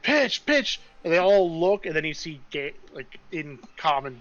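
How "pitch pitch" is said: high loud double call; shrill alarm call. Acted. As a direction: cry it out as a sharp alarm. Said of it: "one of you just starts, like".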